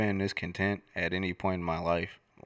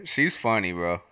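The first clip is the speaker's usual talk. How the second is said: ordinary delivery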